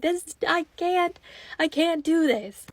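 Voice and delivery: high-pitched, drawn-out mock panic; scared. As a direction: act out being too spooked to go on. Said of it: "it's dark in there and it's scary".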